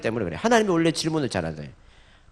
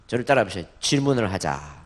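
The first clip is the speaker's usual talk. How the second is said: normal talking voice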